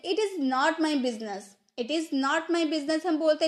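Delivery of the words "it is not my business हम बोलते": normal talking voice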